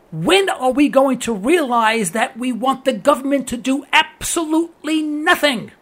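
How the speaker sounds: rising to a sermon; preaching. Real, not acted. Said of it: "don't let me preach here".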